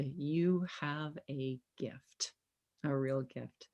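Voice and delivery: soft, slow, breathy-quiet praise; hushed sincere compliment; tender whisper. Real, not acted. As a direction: whisper it gently, like a heartfelt secret. Host's tender closing praise to guest.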